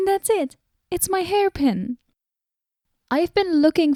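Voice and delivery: light up as it finally clicks; sudden discovery. Acted.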